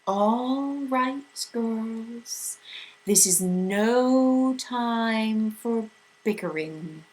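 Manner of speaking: very slow, eerie drawn-out voice; strange otherworldly voice. A narrator's reading. Mrs Which cutting off the bickering, strange and slow. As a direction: speak like a voice from nowhere. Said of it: "sharp, strange voice cut in".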